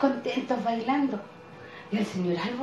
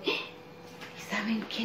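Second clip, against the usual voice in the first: slow, breathless whisper; storyteller teasing the children with a slow whisper; suspense whisper; 8 dB quieter, much slower than usual. Acted. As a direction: lean in and whisper the secret.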